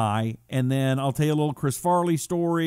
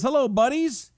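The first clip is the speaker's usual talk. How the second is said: chirpy greeting; 11 semitones higher than usual. Pretend. Voicing viewer greeting.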